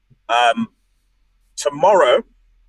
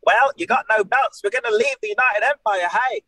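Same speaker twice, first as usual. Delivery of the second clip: higher-pitched mimicry of his teammates; mocking teammates' voices; 8 semitones higher than usual. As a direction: put on their voices, pitched up. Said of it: "they're gonna be like".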